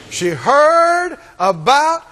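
slow, drawn-out, each word weighed; slow preacher emphasis. Acted. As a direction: stretch it out to land the point.

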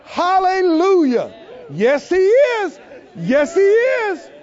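shouting praise high and loud, like a joyful church crowd; ecstatic praise. Retold or imitated. Preacher imitating his sheep, joyful shouting praise. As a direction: whoop and cry out hallelujah. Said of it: "they go".

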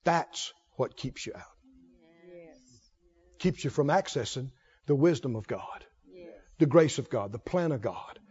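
low, soft, grave pulpit voice; hushed preaching. Real, not acted. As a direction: drop right down and let each phrase land.

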